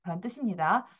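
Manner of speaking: ordinary talk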